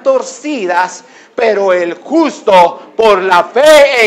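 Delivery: high, drawn-out scriptural proclamation; impassioned preaching. Acted. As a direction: build to a ringing cry. Preaches scripture at full force.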